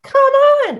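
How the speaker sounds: very high, loud summons; voicing the dog. Dog summons Cliff downstairs, very high and loud. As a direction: call him down like an impatient pup.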